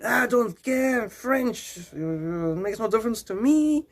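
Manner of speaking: mock French accent. Rude local brushing off a tourist, French accent. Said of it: "Like that".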